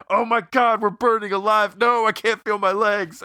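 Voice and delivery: high, loud panicked scream; burning scream. Retold or imitated. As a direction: scream it like you're on fire. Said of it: "he's just so needlessly specific".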